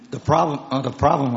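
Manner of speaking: hoarse, strained voice giving out; croaky voice breaking up; losing voice. Real, not acted. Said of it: "I'm losing my voice".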